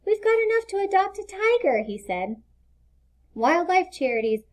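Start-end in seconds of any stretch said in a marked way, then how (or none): 0.1-1.8 put-on happy small boy, higher pitch; young boy Leo. A narrator's reading. Leo telling Mister Green the money is enough, happy boy voice. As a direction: beam it out like a proud little boy.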